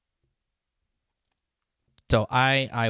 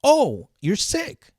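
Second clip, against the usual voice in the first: mocking boss; 9 dB louder, much slower than usual. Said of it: "you're like".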